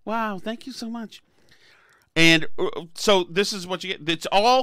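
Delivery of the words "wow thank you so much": softly gushing, starry-eyed; hushed, impressed admirer mocking the media; mock awed fan. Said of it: "And they liked being talked to".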